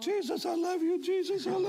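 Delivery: high, tearful cry of devotion; retelling a weeping classroom prayer, high and teary; crying out loud. Retold or imitated. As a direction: sob it out to Jesus, high and aching. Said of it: "I'm crying out loud".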